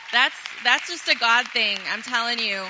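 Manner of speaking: fervent loud praise. Real, not acted. Preacher telling the congregation it's a God thing, loud fervent. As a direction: proclaim it to the whole church.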